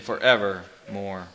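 very low, slow, hushed pronouncement; ominous demon. A narrator's reading. Demon answering the man's question, ominous. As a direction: sink the word into a quiet doom. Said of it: "quoth the demon".

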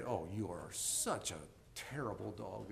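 soft, low, sweet talk to a dog; nice tone, wrong words. Shown as a demonstration. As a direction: say it gently, as if praising the dog. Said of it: "such a nice tone of voice".

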